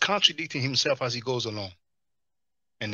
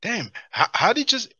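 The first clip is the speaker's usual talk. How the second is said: mock indignant; 9 semitones higher, 6 dB louder than usual. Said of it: "first he's like".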